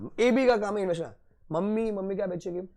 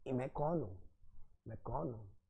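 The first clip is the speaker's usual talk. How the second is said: मैं कौन हूँ dropped much softer and lower; softer repeat; 6 semitones lower, 15 dB quieter than usual. Shown as a demonstration. Teacher repeating the question to himself, very soft. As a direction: let मैं कौन हूँ fall to a near murmur. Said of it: "question repeated softly".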